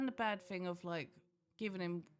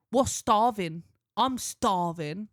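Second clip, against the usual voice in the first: imitating son; 2 semitones higher, 10 dB louder, much slower than usual. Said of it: "he goes".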